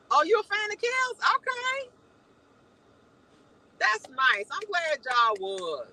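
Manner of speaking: high, animated chat reading; light up reading the comments; excited high voice responding to viewers. Real, not acted.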